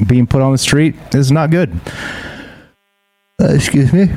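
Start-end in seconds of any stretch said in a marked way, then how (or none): 3.4-4.1 real yawn through the words. Real, not acted. Streamer apologizes to chat while yawning, real yawn. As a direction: excuse yourself mid-yawn. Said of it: "As I yawn here on the stream".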